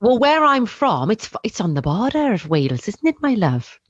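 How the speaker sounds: lilting, put-on Welsh accent. Shown as a demonstration. Asked about accents, host attempts a Welsh accent. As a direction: show off a sing-song Welsh accent. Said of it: "you can do a good, um, Welsh accent".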